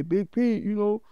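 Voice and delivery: teasing family. Retold or imitated.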